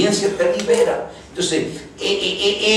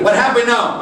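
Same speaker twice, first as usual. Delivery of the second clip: higher and louder, a grandma's sharp question; mock scolding question; 9 semitones higher, 5 dB louder than usual. Grandmother questioning her angry grandson, loud mock scold. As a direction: demand it like a grandmother catching him.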